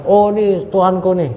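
a hint of mocking wonder at a star; mock delight. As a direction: play along with them, teasingly. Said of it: "ketika mendebat orang-orang kafir".

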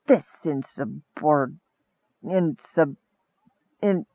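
halting, stumbling over a long word; grumbling. A narrator's reading. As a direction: grumble it, groping for the word and giving up. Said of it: "he grumbled".

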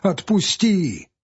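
a peasant's drawn-out plea; pleading peasant. Acted. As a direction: plead to be let go. Peasant begs forester to release.

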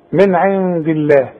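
ordinary talk, nothing marked

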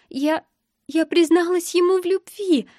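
high-pitched, halting, sobbing voice; tearful confession. A narrator's reading. Sobbing girl confessing to a stranger, tearful. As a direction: stumble at the start, then wail it out.

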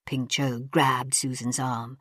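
plain reading voice

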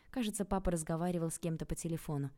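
ordinary delivery

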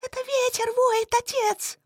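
put-on high, thin young woman's voice; thin girlish voice. Daughter voiced in story.

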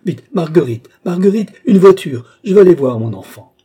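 high, loud frantic order; urgent calling out. Acted. Mother summons Marguerite for a carriage, urgent shouting.

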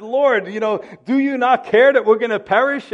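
high, loud, frantic plea; panicked cry. Retold or imitated. Frightened disciples crying out to Jesus in the storm, loud and frantic. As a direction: cry out as if the boat is sinking.

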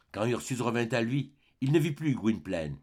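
plain reading voice

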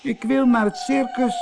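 very high, tearful wail; sobbing. A narrator's reading. Little mermaid says she wants the circus, sobbing. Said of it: "snikte ze".

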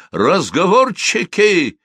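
slow, high shouted reprimand; sharp shout. Acted. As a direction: snap at the grumbling ranks. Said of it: "прикрикнул старшина".

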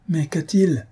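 acted question, pitched high and firming up; voice growing firmer. A narrator's reading. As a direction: ask urgently, steadying the voice. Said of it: "d'une voix qui s'affermissait".

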